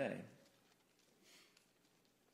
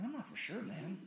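unsure mumble; 5 semitones higher, 8 dB quieter than usual. Retold or imitated. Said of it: "He's like".

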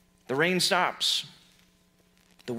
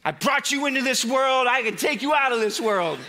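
raised, firm parental scolding; stern parent; 5 semitones higher than usual. Retold or imitated. Jesus as a strict parent scolding the storm. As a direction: scold like a fed-up dad laying down the law. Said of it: "say it like that strongly to your kids".

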